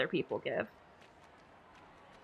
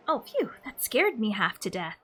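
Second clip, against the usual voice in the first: ordinary talk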